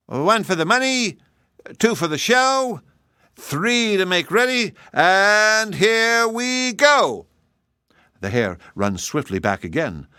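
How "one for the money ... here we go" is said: high sing-song count, drawn-out final go; race count-off. Acted. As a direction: stretch the last words. Hare counts off again.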